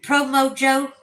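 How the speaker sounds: loud, bright call of a viewer's name; excited greeting. Real, not acted. Streamer greets a chat viewer with a loud excited call. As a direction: call out the name like a happy shout.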